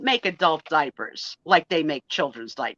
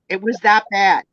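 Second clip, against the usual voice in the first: usual speaking voice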